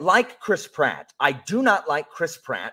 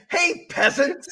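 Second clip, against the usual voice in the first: laughing mock quote; 7 semitones higher, slower than usual. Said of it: "So Mario's saying".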